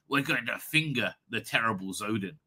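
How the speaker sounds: low, understated delivery; the Doctor. Acted. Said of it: "if the Doctor turned around and says".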